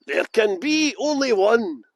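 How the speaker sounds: slow, drawn-out dramatic catchphrase; slow portentous delivery; movie line. Pretend. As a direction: savour each word like a trailer voiceover.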